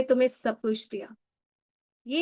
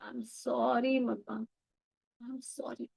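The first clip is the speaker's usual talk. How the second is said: very slow, much softer sorry dying to a breath; tender apology; 8 dB quieter, much slower than usual. Shown as a demonstration. Daughter asking her mother's forgiveness, slow and hushed. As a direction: barely say it, letting it fade out.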